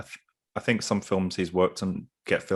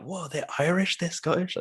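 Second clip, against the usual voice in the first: high-pitched imitation of amazed viewers; mock astonishment; 10 semitones higher than usual. Host imitating viewers discovering actors' real nationalities, mock astonished. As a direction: marvel in a high, put-on surprise.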